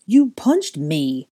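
slow, loud, dazed complaint; dazed, drawn-out accusation; stunned mumble. A narrator's reading. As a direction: say it slowly, nursing a sore jaw. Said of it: "Goyle mumbled, feeling his jaw".

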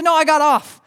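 loud, high mock-frantic protest; freaking out. Wife admits she left the interstate, freaking out. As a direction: imitate someone panicking on the phone. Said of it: "She was freaking out".

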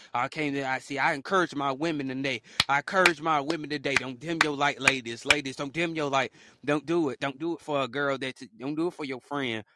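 loud sermon-style exhortation; preaching. Acted. Speaker urging the ladies not to dim, preaching. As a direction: preach it to the women. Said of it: "preaching to ladies".

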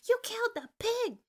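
very high-pitched, hammed-up cry of alarm; high mock-frantic outcry; panicked. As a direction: imitate a horrified protest, voice shooting way up. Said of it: "they're so fucking scared".

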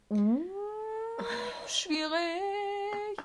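high-pitched sing-song understatement; mock singsong. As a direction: stretch it out way up high, like singing. Retold reaction to bad news.

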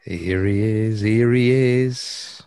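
drawn-out, loud sing-song; drawn-out announcing. Real, not acted. Singsong announcing his arrival.

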